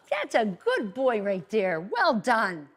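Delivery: slow, drawn-out dog praise. Shown as a demonstration. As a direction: drag out the praise lovingly. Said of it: "I'm gonna show you how that's done".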